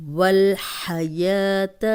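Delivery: والحیات slowly, clear ح, no stretched زبر on و; slow recited demo. Shown as a demonstration. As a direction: recite والحیات slowly, keep the zabar short. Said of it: "اب زبر کو لمبا ہونے سے بچائیں گے". (والحیات آہستہ، زبر لمبا کیے بغیر)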